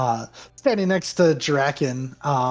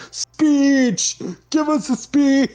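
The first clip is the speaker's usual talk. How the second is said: heckling shout; 12 semitones higher, 5 dB louder, slower than usual. Acted. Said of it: "somebody from the back is just like".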